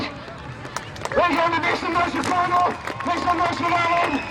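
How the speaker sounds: rally the crowd to applaud; crowd hype. Real, not acted. Calling applause after stunt.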